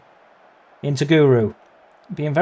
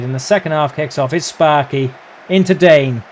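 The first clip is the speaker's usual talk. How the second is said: ordinary talk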